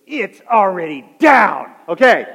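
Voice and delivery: a dry curt put-down from the wife; wife's retort. Retold or imitated. Wife snapping back at her husband in bed, dry and slow. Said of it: "she goes".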